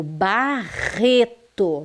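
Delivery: "Barreto" devagar, com RR aspirado; RR bem forte. Shown as a demonstration. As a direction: separe "Barreto" e ponha força no RR. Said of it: "bem forte". (erre forte em "Barreto")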